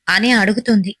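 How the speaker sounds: ordinary delivery, nothing marked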